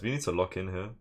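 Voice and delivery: usual speaking voice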